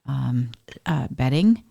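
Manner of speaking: ordinary talk, nothing marked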